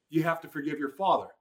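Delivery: a still small voice; God's quiet voice. Retold or imitated. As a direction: say it gently, a quiet command from above. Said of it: "I hear God say".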